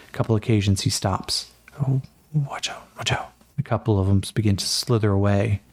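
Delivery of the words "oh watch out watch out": quick hushed warning; urgent whisper. Acted. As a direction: whisper so the snakes stay calm. Stepping among snakes.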